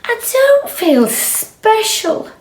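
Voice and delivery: high, slow and mopey; sad cow. Acted. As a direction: moan it like a glum cow. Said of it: "said Marjorie".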